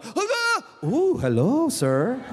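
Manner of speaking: high, loud, flirty "hello"; admiring onlookers. Retold or imitated. Beach crowd admiring the dad ripping off his shirt, flirty coo. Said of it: "The fifty Filipinos like".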